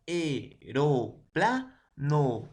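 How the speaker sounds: "Aeroplano" spoken very slowly with the vowels split; slow classroom dictation of "Aeroplano"; syllabified demo. Shown as a demonstration.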